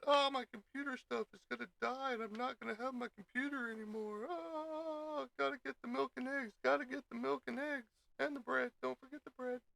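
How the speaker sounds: high, squeaky mock panic voice; a streamer imitating people panicking before Y2K, in a squeaky high voice; mock panicky person. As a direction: mimic a frantic shopper hoarding supplies.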